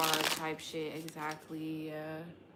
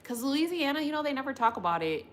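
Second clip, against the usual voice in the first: excited burst; 8 semitones higher, 6 dB louder, faster than usual. Real, not acted. Excited about Louisiana food.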